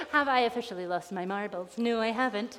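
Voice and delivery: ordinary delivery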